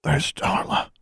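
strained, croaky question; croaked. A narrator's reading. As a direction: croak the question while badly hurt. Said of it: "Alexandra croaked".

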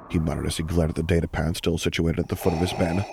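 usual speaking voice

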